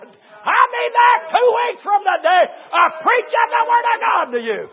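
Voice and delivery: high, shouted preaching; full-force preaching. Real, not acted. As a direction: shout it to the whole congregation with fervor.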